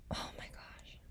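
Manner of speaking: breathy whispered exclamation; whispering. Real, not acted. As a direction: whisper it in awe.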